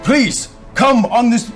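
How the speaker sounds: normal talking voice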